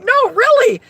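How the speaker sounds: high and loud, pressing the point; mock insisting. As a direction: dig in like a pleading kid. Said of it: "I'm like".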